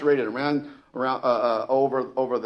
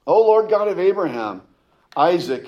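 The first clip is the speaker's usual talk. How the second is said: higher, louder invocation; bold prayer; 5 semitones higher, 6 dB louder than usual. Acted. Elijah calling on God before the people, bold prayer. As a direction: call on God with confidence.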